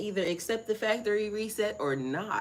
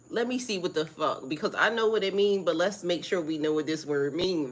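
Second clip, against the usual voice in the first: ordinary talk, nothing marked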